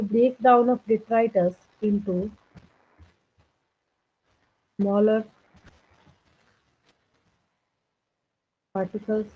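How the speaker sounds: very slow, word-by-word dictation; low-key, measured dictation with long gaps. Real, not acted. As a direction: read it out slowly for note-takers. Dictates notes while writing.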